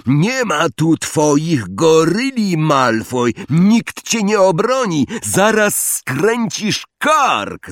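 high, drawn-out shouted threat; Harry calling out. A narrator's reading. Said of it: "zawołał Harry".

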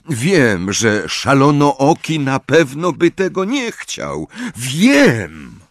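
a touch of a roared outburst; mild roar. Acted. Harry snaps back at his friends, a mild roar. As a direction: bark it out, frustrated and cornered. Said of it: "ryknął Harry".

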